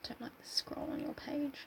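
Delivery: soft unvoiced whisper. Real, not acted. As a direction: whisper it aside.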